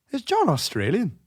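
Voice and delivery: high, tentative query; imitating a puzzled question. Margaret asks about the TV host, high puzzled question. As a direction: lift the pitch as if just wondering.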